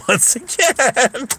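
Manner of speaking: high, slow, laughing words; drawn-out, squeaky with laughter; laughing repeat. Real, not acted. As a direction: stretch it out through laughter.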